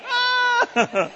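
put-on high startled "ah" from a small child; child's shocked gasp. Child reacting to the bedtime prayer with a high gasp. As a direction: gasp like a scared four-year-old.

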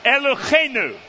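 "Eloheinu" with a throaty, spitting kh in the middle, ell-oh-KHAY-noo; guttural ch. Shown as a demonstration. Teacher showing the class how to say "Eloheinu" with a spitting kh. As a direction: push air hard through the throat on "Eloheinu". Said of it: "if there's not spit on the person".

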